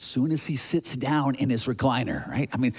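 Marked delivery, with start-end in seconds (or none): none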